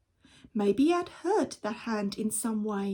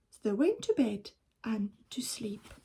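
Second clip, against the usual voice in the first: usual speaking voice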